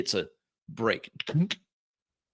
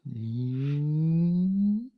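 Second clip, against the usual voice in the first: long, steadily rising hum; charging hum; 5 semitones higher than usual. Acted. Game master imitating weapon powering up, rising hum. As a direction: wind up like capacitors charging. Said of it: "like capacitors charging".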